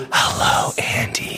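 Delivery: sultry whisper into the mic. Shown as a demonstration. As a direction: breathe his name close to the mic. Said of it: "whisper mouth to mouth into the microphone".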